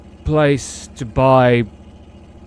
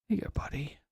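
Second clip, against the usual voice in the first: whisper; 3 semitones higher, 15 dB quieter, faster than usual. Real, not acted. Whispering to cat.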